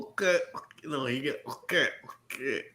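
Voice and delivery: soft open-mouthed mumble; mock mumbling, lips never closing; mouth left open. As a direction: imitate a slack-jawed actor, very quietly. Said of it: "they don't close their mouth".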